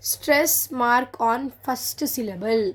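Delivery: very slow, word by word; slow dictation. Shown as a demonstration. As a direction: read the rule out as if dictating. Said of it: "reads rule slowly".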